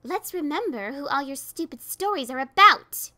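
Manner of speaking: loud furious scream. A narrator's reading. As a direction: scream at her foe. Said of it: "Ditsy screamed".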